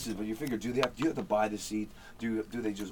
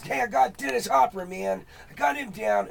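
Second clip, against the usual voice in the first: much higher, louder Dennis Hopper put-on; 11 semitones higher, 5 dB louder than usual. Roommate Jeff bursting in to show his Dennis Hopper. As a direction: brag like Hopper showing it off. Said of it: "he goes".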